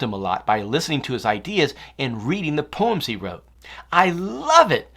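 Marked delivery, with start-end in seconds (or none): 3.9-4.9 high, loud delighted cry; excited exclaim. Acted. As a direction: burst out with delight. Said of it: "she exclaimed".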